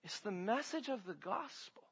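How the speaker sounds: very soft, almost under the breath; hushed answer. Real, not acted. Preacher answers his own question for the congregation, hushed. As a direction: give the answer quietly, held back. Soft first take before emphatic repeat.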